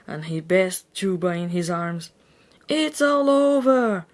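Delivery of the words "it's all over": high, loud, slow dramatic wail; shout. A narrator's reading. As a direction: wail it like a doomed hero. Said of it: "shouted her brother".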